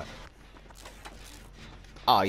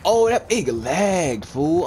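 slow, higher, louder drawn-out oh; indignant surprise; 7 semitones higher, 5 dB louder, much slower than usual. Real, not acted. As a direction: complain loudly that it lagged. Game lags at key moment.

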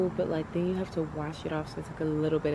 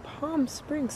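higher, disbelieving question; incredulous; 7 semitones higher than usual. Retold or imitated. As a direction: repeat it in disbelief. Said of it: "I'm like".

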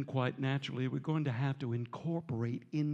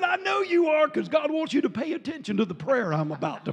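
loud retort; 12 semitones higher, 7 dB louder than usual. Retold or imitated. Preacher answers man loudly.

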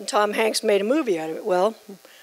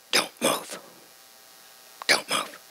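voiceless, softer warning whisper; urgent whisper; 7 dB quieter, slower than usual. Retold or imitated. Father in the boat whispering a warning to his daughter. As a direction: whisper it tense, a snake nearby. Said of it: "He talked in a whisper to me".

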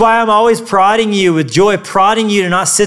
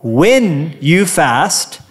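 when you fast drawn out very slowly; slow, stressed congregation cue; call-and-response line; 3 semitones lower, much slower than usual. Shown as a demonstration. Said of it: "say it with me".

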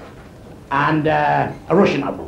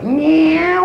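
high, drawn-out imitated cat meow; 9 semitones higher than usual. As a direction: mew it like a big alley cat. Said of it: "the big cat goes".

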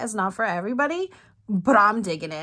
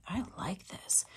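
whispered aside; 2 semitones lower, 12 dB quieter than usual. Real, not acted. Whispered aside while reading.

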